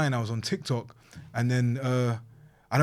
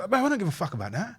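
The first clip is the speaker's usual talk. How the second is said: ordinary talk, nothing marked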